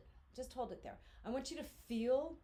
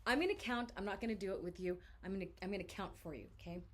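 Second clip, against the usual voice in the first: ordinary talk, nothing marked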